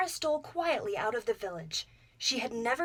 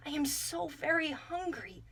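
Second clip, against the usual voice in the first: put-on pleading boy; 5 semitones higher than usual. A narrator's reading. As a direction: beg weakly for food. Boy begs again.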